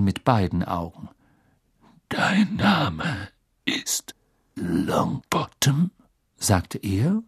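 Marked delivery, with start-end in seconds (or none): 2.1-5.9 put-on slow, halting old Auror voice; stern teacher's question. A narrator's reading. Teacher checking a nervous pupil's name, slow probing growl. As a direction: draw out each word probingly.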